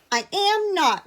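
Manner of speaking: high, loud indignant denial; defiant retort. A narrator's reading. Edith talking back to mister bear, high and loud. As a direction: push back hard. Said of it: "replied Edith".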